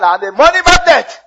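rally the church with a big declaration; loud declaration. Shown as a demonstration. Preacher leading the church in declaring, shouted high and loud. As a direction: shout "I'm a money magnet" high and loud, stressing "magnet". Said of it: "Say that".

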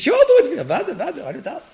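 high, loud laughing reply. Retold or imitated. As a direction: laugh the easy yes. Mocking the easy promise.

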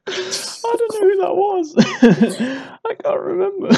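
high, laughing embarrassed denial. Real, not acted. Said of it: "was not looking very happy".

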